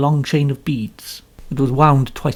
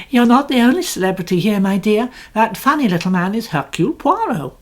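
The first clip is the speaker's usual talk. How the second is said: higher, light and chatty society lady; 8 semitones higher than usual. A narrator's reading. Mrs Allerton to her son, lightly, higher and chatty. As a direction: toss it off lightly to the guest.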